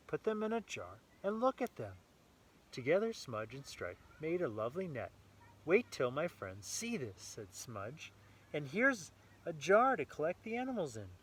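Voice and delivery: lively read-aloud storytelling voice; story narration. A narrator's reading. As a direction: read it brightly to a small child. Reading picture book to child.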